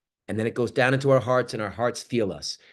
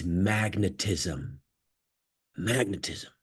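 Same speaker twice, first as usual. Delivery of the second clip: much softer, lower confiding voice; leaning in quietly; 8 semitones lower, 8 dB quieter than usual. Shown as a demonstration. Speaker to the Zoom audience, softly sharing the secret sauce. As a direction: lean in and share a secret. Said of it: "when I talk like this".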